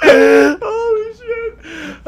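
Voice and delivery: very high, slow, loud laugh-shout; howling laughter. Real, not acted. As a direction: lose it completely laughing. Cracking up at the story.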